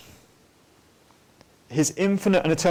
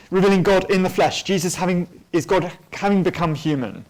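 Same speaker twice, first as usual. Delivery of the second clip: normal talking voice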